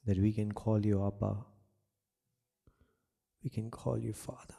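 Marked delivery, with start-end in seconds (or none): 3.4-4.5 unvoiced whisper, softer; whispered prayer. Real, not acted. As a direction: breathe it to God.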